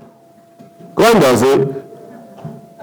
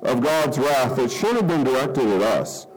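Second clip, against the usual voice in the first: prayer; 4 semitones lower than usual. Acted. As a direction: pray it sincerely before the church. Pastor leads congregation in prayer.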